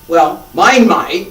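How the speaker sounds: self-mocking chuckle, slow; laughing. Real, not acted. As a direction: laugh at yourself. Jokes his arrow goes wobbly.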